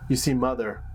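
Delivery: plain reading voice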